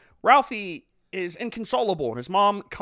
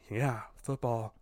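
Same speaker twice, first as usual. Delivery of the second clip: much softer, lower and slow, numb; stunned child answering Santa, soft and low; dazed mumble; 7 semitones lower, 11 dB quieter, slower than usual. Retold or imitated. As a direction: mumble it in shock. Shocked Ralphie mumbling.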